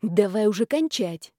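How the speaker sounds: ordinary delivery, nothing marked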